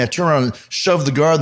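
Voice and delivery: usual speaking voice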